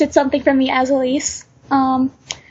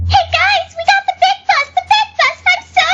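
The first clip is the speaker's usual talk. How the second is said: squeaky-high, excited anime character; thrilled anime squeal, pitched way up; 3 dB louder, faster than usual. Shown as a demonstration. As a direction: gush in a very high cartoon voice. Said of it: "this really high-pitched voice that I can do".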